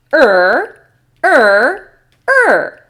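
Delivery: three loud, separate "er" sounds; isolated "er" sounds. Shown as a demonstration. Teacher gives answers for the three sisters, loud isolated "er". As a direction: punch out each "er", pitch climbing to the last. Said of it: "That the sounds are".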